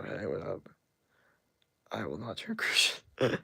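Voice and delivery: whispered with a laugh. Real, not acted. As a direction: breathe it out, amused. Whispered refusal to chat.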